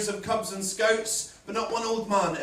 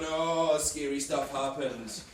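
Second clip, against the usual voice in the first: mock spooky tour guide; 5 semitones lower, 5 dB quieter, much slower than usual. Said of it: "an out-of-work actor just going".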